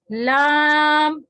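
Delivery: "لام" very slow, tongue tip; letter drawn out. Shown as a demonstration. Teacher drawing out "لام". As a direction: stretch "لام". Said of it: "یہ بھی زبان کی نوک سے".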